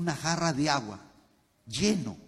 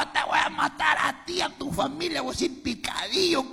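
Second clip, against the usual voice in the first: high, shouted threat; furious high shout, then fading; 11 semitones higher than usual. Retold or imitated. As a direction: yell it down the phone like a killer. Threatening the cousin on phone.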